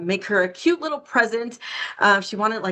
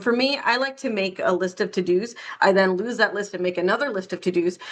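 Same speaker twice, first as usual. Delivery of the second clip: ordinary talk, nothing marked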